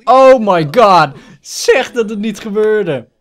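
drawn-out, slow cry of disbelief; loud dismay. Real, not acted. Player begging that it didn't happen, dismayed. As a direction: wail it out slowly in horror. Dismayed reaction to game event.

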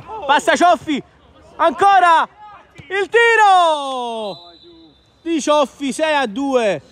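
rising excited goal call; breathless italian goal commentary. Real, not acted. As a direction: build up fast to the goal. Goal build-up in live commentary.

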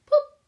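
high, loud little boop; a squeaky loud boop as one disappears; boop sound. Acted. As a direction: pop out a cartoon boop. Sound for taking one away.

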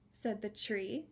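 ordinary talk

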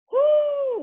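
high-pitched celebratory whoo; high whoo cheer. Real, not acted. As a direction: whoop to end the set. Cheer ending the set.

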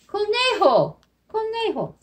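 high answer; excited reveal. Shown as a demonstration. Teacher revealing the rabbit word, high and bright. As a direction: call it out brightly.

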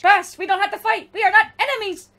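high, loud, pleading cartoon-hero voice; desperate young hero calling out; pleading robot hero. Acting out a game cutscene plea.

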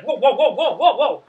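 loud, high startled whoas; retold panic. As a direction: back off from a charging goose, hammed-up. Retold panic at charging goose.

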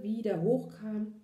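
usual speaking voice

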